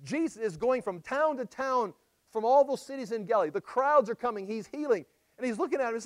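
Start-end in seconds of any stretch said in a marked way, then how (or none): none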